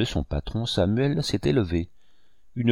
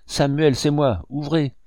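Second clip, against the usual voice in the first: higher, urgent plea; frantic, trembling; 6 semitones higher than usual. A narrator's reading. Begging Samuel to open the door, frantic. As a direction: plead urgently, pitch raised. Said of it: "d'une voix éperdue et tremblante".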